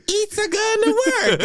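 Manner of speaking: very high cartoon Italian accent; hammed-up, squeaky Italian accent; fake Italian plumber. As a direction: put on a silly Italian plumber voice. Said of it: "a fucking Italian plumber".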